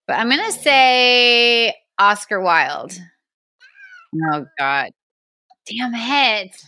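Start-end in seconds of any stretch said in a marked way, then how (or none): none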